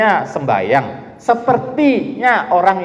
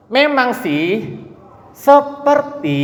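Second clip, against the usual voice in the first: 1 sarcastic drawl; 7 semitones higher, much slower than usual. Shown as a demonstration. Teacher mocking pseudo-piety.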